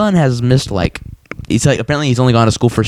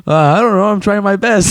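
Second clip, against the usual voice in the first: Morty voice; 10 semitones higher than usual. Retold or imitated. Retelling Morty's voice.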